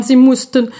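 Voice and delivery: ordinary delivery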